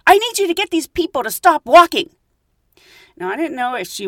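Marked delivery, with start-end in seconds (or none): 0.1-2.1 high, loud imitation of a crazy lady; frantic woman. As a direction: demand it like a frantic passenger. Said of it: "And she's like".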